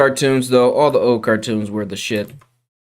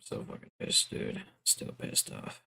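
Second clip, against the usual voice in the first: whisper; 19 dB quieter than usual. Real, not acted. Muttering while upset.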